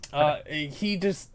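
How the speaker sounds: usual speaking voice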